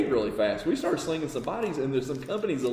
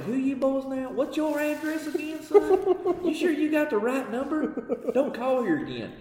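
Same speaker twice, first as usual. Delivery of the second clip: high, hammed-up drawling yokel; lightly mocking Southern supplier; mock Southern good old boy; 13 semitones higher than usual. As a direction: sneer at the upstart caller like a backwoods dealer. Deep south supplier voiced, mocking.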